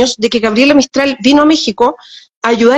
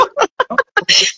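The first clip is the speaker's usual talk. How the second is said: laugh; 10 semitones higher, 4 dB quieter than usual. Real, not acted. Amused at her own accent.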